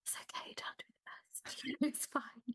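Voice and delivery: breathy whisper to herself, then a laugh. Retold or imitated. Whispering to herself that being the best can wait. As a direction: hush it like a secret permission. Self-talk telling herself to relax.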